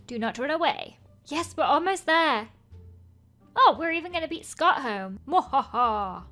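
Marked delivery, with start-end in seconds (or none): 5.3-6.2 slow theatrical villain menace. Acted. Gloating about beating Scott home.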